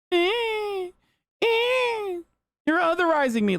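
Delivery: very high mocking baby whine; whiny baby voice. Mocking conservatives as crybabies, with a very high whine. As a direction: whimper it like a spoiled toddler. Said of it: "shut the fuck up, idiot".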